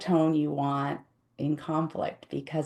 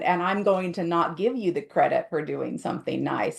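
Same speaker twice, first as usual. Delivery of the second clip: normal talking voice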